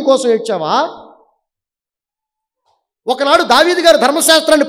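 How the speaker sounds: high, loud, hammering questions; full-force preaching. Real, not acted. Preacher challenges listeners on what they weep for, full-force preaching. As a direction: challenge the congregation hard, phrase after phrase.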